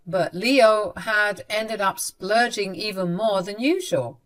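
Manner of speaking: ordinary delivery, nothing marked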